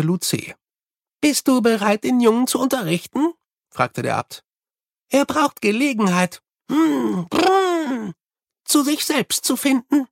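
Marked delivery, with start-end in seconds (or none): 1.2-3.3 put-on much higher, childlike babble of the infant abbot; a toddler-voiced old abbot, curious; babbling baby abbot. A narrator's reading. 5.1-10.1 put-on much higher, childlike babble of the infant abbot; a toddler-voiced old abbot, curious; babbling baby abbot. A narrator's reading.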